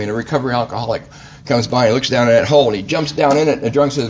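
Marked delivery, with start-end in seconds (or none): none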